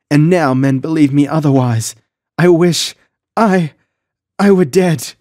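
high, anguished tearful confession; acted distress, voice high and broken by pauses; sobbing. A narrator's reading. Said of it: "she sobbed".

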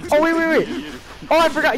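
surprised. Real, not acted. Realizes mistake in game.

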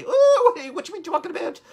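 hammed-up, high-pitched mock Irish accent, opening on a loud whoop; terrible Irish accent. Host jokes about Saint Patrick's Day, in a terrible high Irish accent. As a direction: squeal out a badly faked Irish lilt, quickly. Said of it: "That's a terrible accent".

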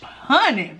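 high giggling laugh. Real, not acted. Savoring food, bursting into laughter.

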